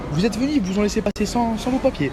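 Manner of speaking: rising, astonished official voice; surprised official. Retold or imitated. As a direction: act shocked at no papers. Imite l'agent surpris. (agent étonné, voix qui monte)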